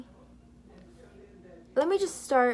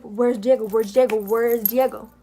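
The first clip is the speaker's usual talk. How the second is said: louder, insistent repeated demand; 6 dB louder than usual. Retold or imitated. Brandon loudly demanding Alex tell him where Diego is. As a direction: hammer the question each time.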